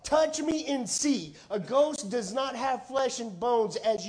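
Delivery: scripture reading. Acted. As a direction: read the gospel out to the church, slowly.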